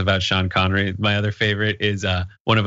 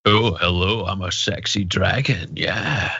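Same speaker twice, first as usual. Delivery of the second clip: a Scottish-tinged purring dragon voice; sexy Sean Connery dragon; slower than usual. Retold or imitated. Co-host imitating Connery's dragon role, lightly Scottish-tinged and purring. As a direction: seduce the listener as Connery's dragon. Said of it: "He was a dragon".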